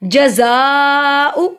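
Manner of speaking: जज़ाउ with ज़ा held very long; very slow, sustained ज़ा in जज़ाउ; triple-length madd. Shown as a demonstration. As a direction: stretch ज़ा to great length, then a short उ. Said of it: "तीन अलिफ के बराबर लंबा होगा".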